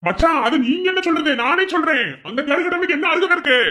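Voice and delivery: high, sharp, outraged challenge; indignant outburst. Acted. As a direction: throw the question back at him in anger. Family quarrel at a function.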